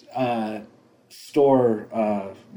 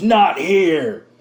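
mock yell, higher and louder; retold yell; 6 semitones higher, 7 dB louder than usual. Quoting the script's hero to co-host, mock yell. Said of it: "like, yells at Turnbull".

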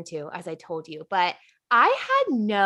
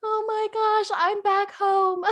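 retold dismay, pitched way up; high-pitched exclamation; 12 semitones higher, slower than usual. Host retelling her reaction to sounding country, high mock exclamation. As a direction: squeal it high, drawing out the end.

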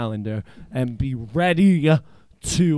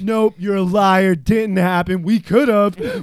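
louder, higher indignant denial; loud protest; 5 semitones higher, 7 dB louder than usual. Real, not acted. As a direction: protest it like he is lying to you. Angry denial of the story.

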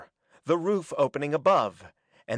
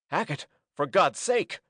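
raised, urgent plea; desperate plea; 5 semitones higher than usual. A narrator's reading. Norman calls to Hackett mid-combat, desperate plea. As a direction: call out to stop a friend.

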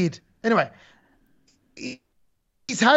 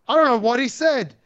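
usual speaking voice

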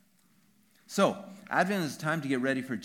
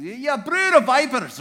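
thundering Scottish denunciation, higher and louder, slow; Scottish accent; 11 semitones higher, 14 dB louder than usual. Shown as a demonstration. John the Baptist condemning Pharisees in a Scottish accent. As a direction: spit it at the Pharisees in broad Scots. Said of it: "He has to say it with a Scottish accent".

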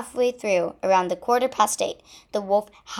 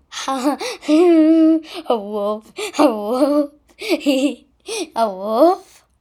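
mocking laughter through words; laughing; 6 semitones higher, 7 dB louder, slower than usual. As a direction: laugh in her face. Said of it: "laughed Lucy the goose".

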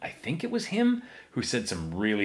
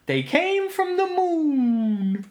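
very high, slow, drawn-out alien voice; hammed-up high moon voice; 3 dB louder, much slower than usual. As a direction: stretch it out like a silly spaceman. Moon voice joke.

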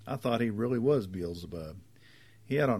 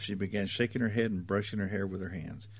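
ordinary delivery

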